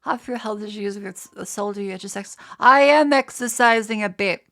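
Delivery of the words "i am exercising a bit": loud, drawn-out protest; indignant retort. Real, not acted. Streamer answering viewer's exercise advice, indignant. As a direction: push it out loudly and slowly.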